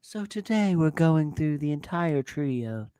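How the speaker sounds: low, slow, soft tingles voice; a hushed mock ASMR channel opening; slow deep parody ASMR; mock ASMR intro. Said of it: "would just be like".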